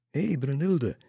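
high, loud hail; calling out. A narrator's reading. Ladies hail Lisse in the cemetery, calling out. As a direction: call out to a friend.

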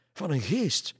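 high, loud exclamation on GEEST; crying out. A narrator's reading. Pastor identifies the shirt's owner, crying out. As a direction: cry out "geest" in alarm. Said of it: "riep de pastoor".

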